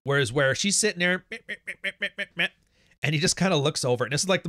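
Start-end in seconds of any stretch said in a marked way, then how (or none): 1.3-2.5 higher mocking meh, meh, nagging babble; mock yapping noise. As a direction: mimic her droning on dismissively.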